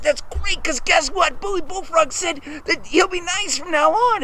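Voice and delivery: high-pitched, eager burst; excited news-sharing. Acted. Junior Bear tells dad the good news, excited.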